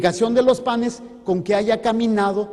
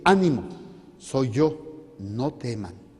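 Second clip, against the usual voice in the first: calm frightened friends tenderly; gentle reassurance; 7 semitones lower than usual. Acted. Jesus reassuring the disciples.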